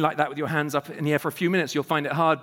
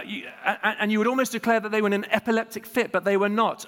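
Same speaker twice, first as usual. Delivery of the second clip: usual speaking voice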